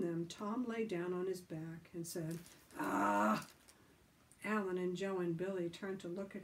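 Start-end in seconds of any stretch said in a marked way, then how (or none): 2.8-3.5 deep, loud groan; low groan. Acted. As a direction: groan on his back. Tom groaning.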